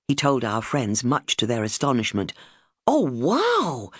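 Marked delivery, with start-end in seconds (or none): 2.9-3.9 high, astonished exclamation; gasping wow. Retold or imitated. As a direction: gasp it in astonishment. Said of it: "they all gasped".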